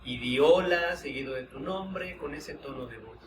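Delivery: calm, unhurried guiding voice with long pauses; guided meditation. Acted. Teacher guiding students through a voice-tone exercise, meditative. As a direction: lead the group inward through the exercise, gently.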